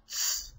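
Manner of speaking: "ts" as a short hiss with t first; a quiet, isolated "ts" hiss; hissing sound. Shown as a demonstration. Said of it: "a sort of buzzing or hissing noise".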